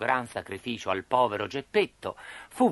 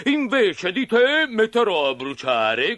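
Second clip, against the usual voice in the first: gruff puppeteer; 7 semitones higher, 4 dB louder than usual. Acted. Puppeteer sparing Pinocchio but needing firewood, menacing. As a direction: declare it as a fearsome boss. Mangiafuoco figure.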